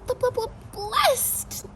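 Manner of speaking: stammering. Acted. Stammering on blessed.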